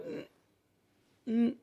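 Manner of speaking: stifled closed-mouth "mm"; muted attempt to speak. Storyteller shows how he could not call out to Jesus, muffled hum. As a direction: imitate trying to talk with the mouth sealed. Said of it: "and nothing would come out".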